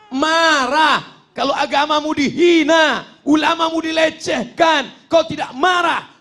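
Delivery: high, loud, shouted preaching; rising fervour. Real, not acted. Preacher stirring the crowd to anger, shouting high. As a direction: whip the crowd up.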